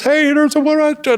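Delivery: high, pushy call; urgent Paul. Retold or imitated. Paul pointing out the exit, high urgent call. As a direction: point it out in a squeak.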